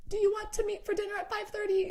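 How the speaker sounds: high, mock-solemn prayer voice; hammed-up praying in a high singsong; Dean. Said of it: "that's, like, how they talk".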